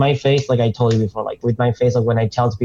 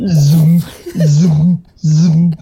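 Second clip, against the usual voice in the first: zoom sound; 3 semitones higher, 5 dB louder, much slower than usual. Pretend. Lightsaber sound effect imitated.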